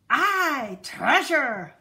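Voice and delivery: loud pirate cheer; shouted. A narrator's reading. Crew cheering about the treasure, loud shout. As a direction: bellow it like a rowdy crew. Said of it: "the others shouted".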